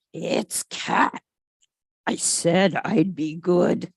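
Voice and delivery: a hint of a dog fighting temptation; lightly tempted George. A narrator's reading. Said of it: "George thinks".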